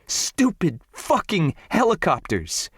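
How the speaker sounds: high, raging curse; furious. A narrator's reading. Raging woman cursing her ex's helicopters, high and furious. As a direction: spit it out high at the door.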